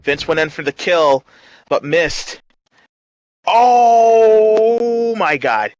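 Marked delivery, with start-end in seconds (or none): 3.5-5.7 surprised shout. Acted. Shock at the move.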